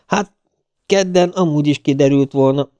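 lightly amused admission; grinning reply. A narrator's reading. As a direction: say it through a grin.

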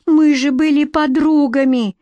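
high-pitched pleading outcry; crying out. A narrator's reading. Queen dodges rival's sword, crying out. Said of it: "выкрикнула Красная Шапочка".

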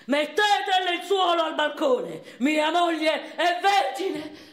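proud shout. Retold or imitated. Husband shouting from the bedroom to the household, loud and boastful. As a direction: bellow it out to the street. Husband proclaims bride's virginity.

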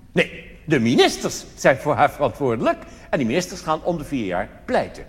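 ordinary talk

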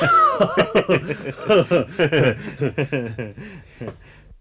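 langes, lautes Auflachen; langes Lachen. Acted. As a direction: lacht lange und laut los. Eisenring lacht über die Wahrheit. (langes lautes Gelächter)

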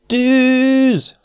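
high, loud cry of fire; shout of alarm. A narrator's reading. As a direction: yell it out in panic. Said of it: "futott végig a kiáltás". (rémült kiáltás)

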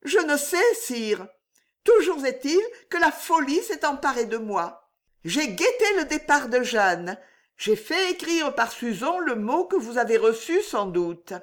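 a hint of a young woman in love; Juliette's voice.

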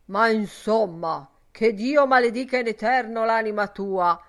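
loud, furious curse; angry outburst at full volume; furious growl. Acted. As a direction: growl it out in a rage. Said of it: "ringhiò furiosamente Ben Dollard".

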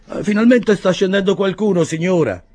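a gruff-comic bearer, relieved at last; short bearer's voice. A narrator's reading. Short bearer telling the lady someone is coming, high rough voice. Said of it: "disse il portatore basso".

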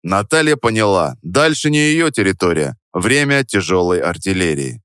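ordinary delivery, nothing marked

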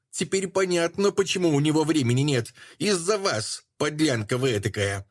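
shrill screeching. Acted. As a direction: screech it at her, triumphant. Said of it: "пронзительно заверещала".

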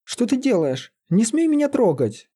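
very high, outraged protest; indignant cry. A narrator's reading. Лиза wards off the sorcerer who kissed her, indignant. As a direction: push the pitch up, not the volume. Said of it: "крикнула она".

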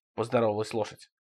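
usual speaking voice

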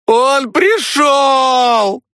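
very high, slow drawn shriek. A narrator's reading. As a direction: shriek a warning from outside. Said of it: "визжала с улицы Свана".

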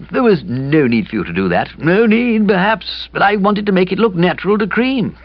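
sheepishly explaining a blunder; gloomy young man voice. A narrator's reading. Kipper defends himself.

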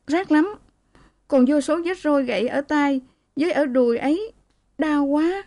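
high, plaintive complaint of hurting; crying out in pain. A narrator's reading. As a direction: wail mildly at a touched wound, pitched high. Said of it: "Cô tránh và kêu".

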